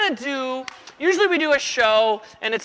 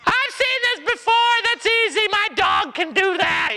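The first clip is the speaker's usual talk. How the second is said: much higher, mocking bored brat; know-it-all kid; 10 semitones higher than usual. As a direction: sneer like a kid who's seen it all.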